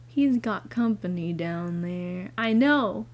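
ordinary delivery

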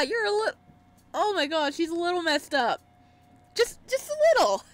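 usual speaking voice

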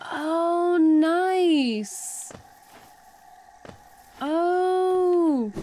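high, drawn-out delight; thrilled, pitch lifted. Real, not acted. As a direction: exclaim with rising excitement. Pleased by new ability in game.

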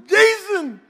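put-on high, whiny little-boy voice, slowing at the end; crying little boy. Preacher voicing a little boy complaining to his brother, high and whiny. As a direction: play a hurt kid telling on the bully. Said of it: "crying his eyes out".

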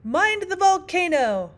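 high, loud, drawn-out warning; storybook queen's slow, high shout; calling out. A narrator's reading. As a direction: call a warning across the room, stretching it out.

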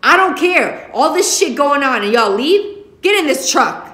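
high, loud furious order; angry shout. Acted. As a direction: bark them into the truck. Father ordering kids into truck.

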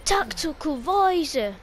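put-on a shaky American accent drifting English; attempted American accent. Streamer reading a game line in a failed American accent. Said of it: "when trying to do an American accent".

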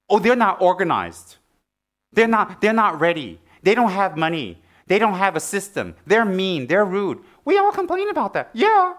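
high, loud whiny complaining; mock complaint. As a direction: whine like a petty grumbler. Mocking complaints, pitch soaring.

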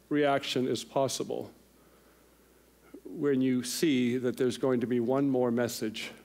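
usual speaking voice

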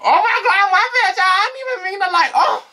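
very high, laughing mock apology; squeaky apology. Vlogger mimicking her own squeaky apology to onlookers. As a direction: squeal out an embarrassed sorry.

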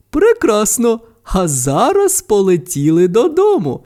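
high, animated mother character voice; mama panda. A narrator's reading. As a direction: delightedly usher a child home. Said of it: "кивнула дочці мама панда".

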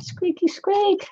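high, slow squeaky mouse voice; high slow mouse squeak. Pretend. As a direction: stretch it out like a tiny mouse. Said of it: "Sounds like a mouse".

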